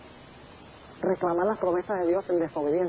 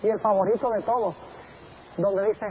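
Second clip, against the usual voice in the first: ordinary talk, nothing marked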